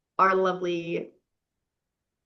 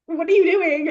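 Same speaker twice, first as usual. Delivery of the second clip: higher mock-startled "what are you doing"; acted startled question; 7 semitones higher, 4 dB louder than usual. Heroine to lover going down on her, acted startled question. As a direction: play her taken aback, pitched up.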